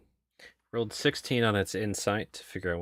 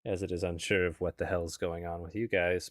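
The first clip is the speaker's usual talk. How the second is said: ordinary talk, nothing marked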